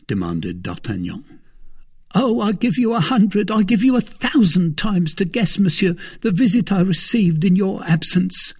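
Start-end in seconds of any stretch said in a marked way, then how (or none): 2.1-8.5 higher, louder anxious servant voice; a jittery valet blurting out news; frightened servant Planchet. A narrator's reading.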